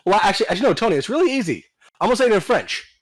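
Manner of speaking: high whiny mock voice; mocking whiny user. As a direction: mimic a pestering user. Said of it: "mocking the user, Tony".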